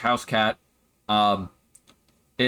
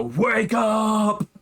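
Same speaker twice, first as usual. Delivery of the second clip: booming call, higher and far; magically amplified voice; 9 semitones higher than usual. Retold or imitated. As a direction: shout it like a thunderclap. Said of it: "his big booming voice go".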